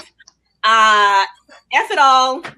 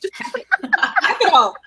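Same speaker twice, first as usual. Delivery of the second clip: slow, laughing declaration, higher; a giddy laughing rallying cry; 6 semitones higher, much slower than usual. Real, not acted. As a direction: drawl it out through laughter.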